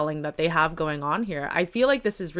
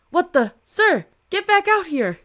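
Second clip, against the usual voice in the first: calling out; 10 semitones higher, 6 dB louder than usual. Acted. Officer yelling at the man running into the fire. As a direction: yell for him to come back.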